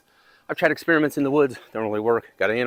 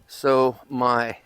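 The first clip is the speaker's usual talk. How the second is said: ordinary delivery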